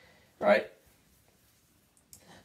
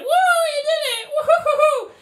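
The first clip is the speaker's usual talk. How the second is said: very high, laughing, loud whoop; cheering crowd; 7 dB louder than usual. Retold or imitated. Livestream crowd celebrating the win, laughing high cheer. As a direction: whoop it up like a giddy fan.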